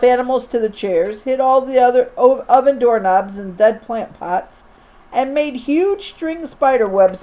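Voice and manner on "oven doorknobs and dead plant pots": ordinary delivery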